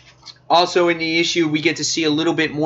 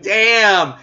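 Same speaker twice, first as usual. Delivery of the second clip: louder, higher stunned "Damn"; shocked exclamation; 6 semitones higher, 4 dB louder than usual. Retold or imitated. Reviewer reacting to the stabbing spoiler, shocked outburst. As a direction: blurt it out like the twist just hit you. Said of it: "I was like".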